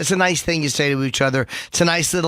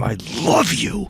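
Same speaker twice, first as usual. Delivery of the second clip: intense; slower than usual. Shown as a demonstration. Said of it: "say it intense the first time".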